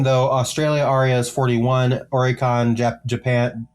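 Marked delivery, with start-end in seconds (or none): none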